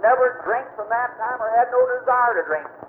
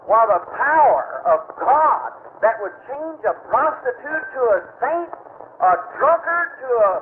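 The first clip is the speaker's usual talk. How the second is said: raised, higher revival preaching; preaching at full force; 6 semitones higher than usual. Acted. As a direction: build the sermon to its peak.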